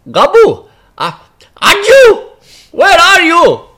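loud, high royal call; king calling. Acted. The king shouts for Gabu from the mountaintop, loud and high. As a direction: summon your servant across the flood.

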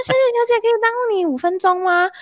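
bright high-pitched call of a chasing saleswoman. Retold or imitated. Saleswoman chasing the speaker on the street, calling out high and eager. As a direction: sing it out high and eager, like a clerk chasing a passerby.

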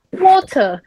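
lightly done British "Water"; British accent. Retold or imitated. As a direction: mimic the son's British "Water". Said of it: "他变成英国腔".

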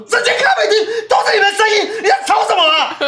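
loud, high laughing shout; furious yell. Retold or imitated. Reenacting snapping at the loud café customers, high laughing shout. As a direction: blow up at the noisy table, half laughing. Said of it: "我怎么发疯了？".

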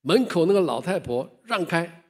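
put-on shouted high and loud at the old woman; dying man shouting to his mother's ghost, loud yell. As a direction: yell at her to get out of the way. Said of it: "大声地喊着说".

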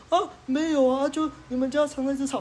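high, meek imitation of a backing-down neighbor; deflated neighbor. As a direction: play the neighbor losing his nerve. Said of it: "他突然就整个气势就弱掉".

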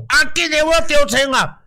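playful mock yell of a gunshot patient; mock pained yell. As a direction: ham up a wounded man's cry for the doctor. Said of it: "就像馆长这种".

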